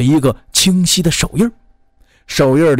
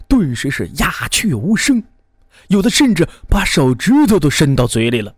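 usual speaking voice

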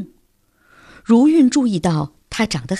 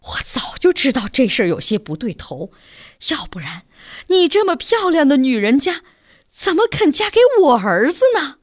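sighing lament that climbs higher into tears; tearful rising lament; 6 semitones higher than usual. A narrator's reading. Mother laments to the bride, close to crying. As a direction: sigh it, then let the voice break upward. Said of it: "叹气说".

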